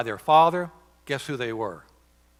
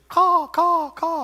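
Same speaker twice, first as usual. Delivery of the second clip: high, loud imitated crow cawing; crow caws; 7 dB louder, much slower than usual. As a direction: caw like a crow circling ruins. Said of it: "will have birds in their windows".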